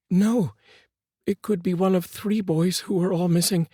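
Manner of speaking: distraught mother. A narrator's reading. Mother answering her husband, lightly distraught.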